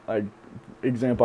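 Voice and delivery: ordinary delivery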